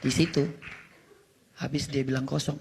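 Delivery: ordinary talk, nothing marked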